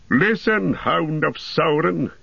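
high, slow ringing command; commanding cry. A narrator's reading. Gandalf facing the wolf captain, crying out a command. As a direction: call the wolf to heel with authority. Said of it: "he cried".

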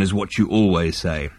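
low alien rumble, slightly dropped; Vogon rumble. A narrator's reading. Vogon captain answering his psychiatrist, rumbling. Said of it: "rumbled the Vogon".

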